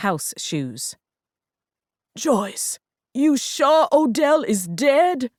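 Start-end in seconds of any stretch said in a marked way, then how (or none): 2.2-5.3 slow, higher mock holler from daddy; father hollering at his daughter. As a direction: call out to his daughter, alarmed. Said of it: "Daddy hollered".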